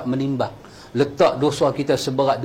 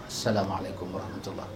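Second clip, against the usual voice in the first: near-silent salam; 10 semitones lower, 14 dB quieter, faster than usual. Shown as a demonstration. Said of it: "Bagi salam terus".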